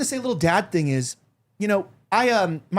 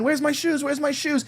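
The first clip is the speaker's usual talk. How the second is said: higher-pitched imitation of an eager child; excited little kid; 8 semitones higher than usual. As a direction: play a small boy excitedly demanding his shoes. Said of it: "And he's excited".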